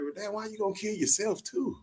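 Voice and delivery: put-on high, baffled little-kid voice. Son asks his mama why she would kill herself too, in a high baffled voice. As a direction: squeak it out like a confused child. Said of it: "I was like".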